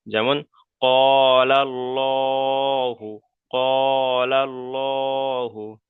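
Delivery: ক্বালাল্লাহু chanted slowly with a full, heavy "lla"; slow recitation of ক্বালাল্লাহু; heavy Allah. Shown as a demonstration. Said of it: "আল্লাহ শব্দটাকে পুর করে পড়তে হবে".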